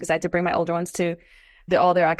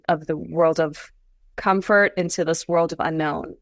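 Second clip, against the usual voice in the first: ordinary talk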